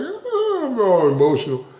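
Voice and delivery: high-pitched, laughing mock wail; playfully blubber, half laughing; mock crying. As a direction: send up your own weepiness with a high whine. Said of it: "I almost cry over anything".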